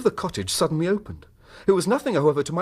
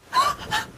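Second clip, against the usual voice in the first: a sharp, high gasp of shock; startled gasp. Acted. Wife caught sneaking in, a sharp gasp.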